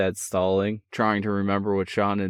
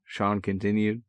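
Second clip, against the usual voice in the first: ordinary delivery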